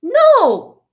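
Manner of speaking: loud, high NO falling sharply; demonstrated angry shout; angry refusal. Shown as a demonstration. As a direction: snap out an angry "No!". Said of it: "when you're angry and say".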